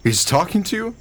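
can't believe what he's hearing; incredulous. Acted. Incredulous husband.

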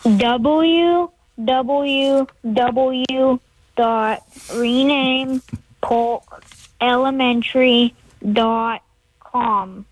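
slow, carefully spelled out web address; over enunciating. Shown as a demonstration. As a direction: spell each part slowly and clearly. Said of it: "one more time slowly".

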